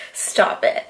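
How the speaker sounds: breathy whispered brush-off to the compliment; flustered whisper. Retold or imitated. Telling her childhood crush to stop, in a whisper. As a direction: whisper it, flattered and embarrassed.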